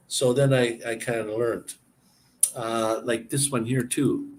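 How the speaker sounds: normal talking voice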